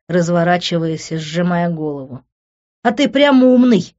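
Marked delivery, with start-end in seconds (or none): 2.8-3.9 loud, high, mocking retort; sarcastic, emphatic taunt; sneering. A narrator's reading. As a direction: jeer with a smirk. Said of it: "усмехнулся Тео".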